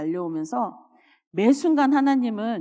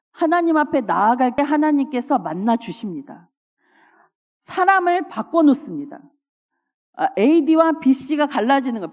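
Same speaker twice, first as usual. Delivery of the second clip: louder, higher pulpit proclamation; fervent preaching; 4 semitones higher, 6 dB louder than usual. Acted. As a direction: preach it out with rising fire. Preaching at full force.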